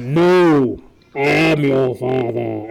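slow, loud Vader voice through a cup; correct Vader line. Shown as a demonstration. Host giving the real quote as Vader, slow and loud. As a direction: deliver it heavy and slow, villain reveal. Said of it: "And then Luke goes".

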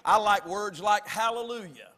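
usual speaking voice

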